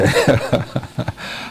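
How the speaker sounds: unforced burst of giggling; real laugh. Real, not acted. Presenter amused by a reader's reply, laughing. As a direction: break into laughter. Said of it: "I'm laughing".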